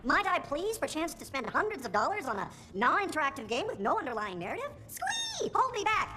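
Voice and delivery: high, swooping fake excitement; over-the-top sarcastic fangirling; heavy sarcasm. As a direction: gush with mock eagerness, up to a squeal. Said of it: "This is sarcasm, by the way".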